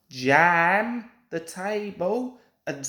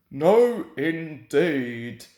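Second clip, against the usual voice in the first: huffily; 4 semitones lower, 3 dB louder, much slower than usual. A narrator's reading. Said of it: "said Gordon huffily".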